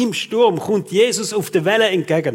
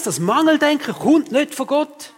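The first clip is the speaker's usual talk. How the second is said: "Mangeldenken" thrust out much higher; pitched-up sermon punch on "Mangeldenken"; emphatic declaration; 9 semitones higher than usual. Real, not acted. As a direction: preach it: hit "Mangeldenken" hard and high. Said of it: "ich sage euch eins".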